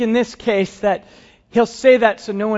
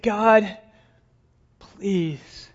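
broken plea. Acted. Abraham about to strike, pleading with God, fading. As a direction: let the please die away.

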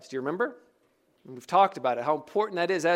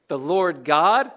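slow, louder "Lord God", rising on "God"; emphasis on the name; 5 dB louder, slower than usual. Shown as a demonstration. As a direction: stretch it and punch "God" upward. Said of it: "What's the emphasis?".

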